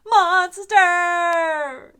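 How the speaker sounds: high, loud cheer. Acted. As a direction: cheer the creation on. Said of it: "they all cheered together".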